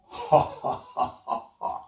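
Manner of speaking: high, loud mocking cackle; evil laughter. Spirit laughing at the failed arrow, high loud cackle. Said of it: "the thing laughed again".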